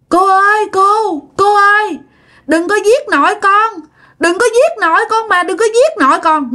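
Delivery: put-on high-pitched child's cry for mercy; screaming child. Child begging a woman to spare grandma, screaming. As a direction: imitate a terrified child's pleading yell. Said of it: "kỳ này nó hét làm sao".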